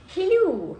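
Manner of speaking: squeaky high greeting; high hello. Pretend.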